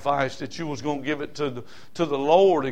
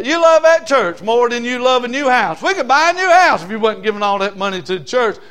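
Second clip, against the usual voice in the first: raised, higher whiny voice of a nagging critic; mock complaining critic; 7 semitones higher, 7 dB louder than usual. Preacher mimicking a grumbling spouse, louder and higher. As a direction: mimic the member who begrudges the tithe, louder and higher.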